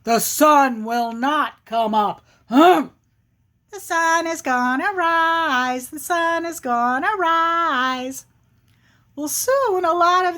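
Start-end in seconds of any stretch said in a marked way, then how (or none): 0.0-2.9 emphatic bear chant with punched humphs; grumpy, insistent storybook bear; stubborn bear. Acted. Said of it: "the bear is saying". 3.7-8.2 high, drawn-out singsong taunt; teasing little squirrel chant; smart-alecky squirrel. Acted. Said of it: "that little smart alecky squirrel is saying".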